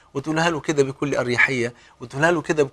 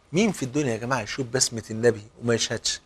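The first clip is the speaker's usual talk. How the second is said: normal talking voice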